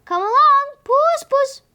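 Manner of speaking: high, loud calling out into the night; calling loudly. A narrator's reading. Husband at the scullery door calling the cat, loud high call. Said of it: "He called loudly".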